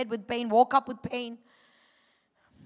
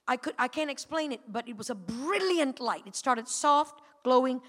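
ordinary talk

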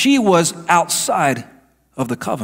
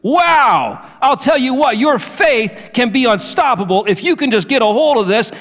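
preaching at full force; 6 semitones higher than usual. Acted. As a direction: rise into a fervent climax. Sermon builds to full force.

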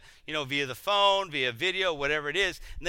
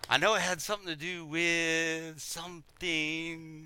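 slow, softer, drawn-out bluff; stalling, backtracking; 6 dB quieter, slower than usual. Acted. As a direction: stretch the words while searching for an answer. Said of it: "start backtracking".

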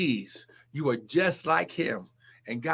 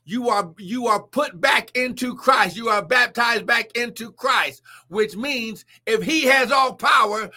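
shouted, higher and louder sermon climax; full-force preaching; 4 semitones higher, 4 dB louder than usual. Real, not acted. Preacher to congregation, shouting the promise of all power. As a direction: preach it to the rafters.

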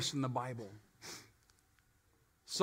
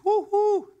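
high squealed woo-hoo, much higher and louder; mock woo-hoo; 14 semitones higher, 7 dB louder than usual. Preacher imitating a happy vacationer's high cheer.

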